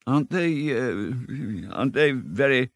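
mildly nervous Mole, hesitating with an uh; a trifle nervous. Acted. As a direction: ask timidly, halting twice. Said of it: "said the Mole a trifle nervously".

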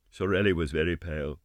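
ordinary delivery

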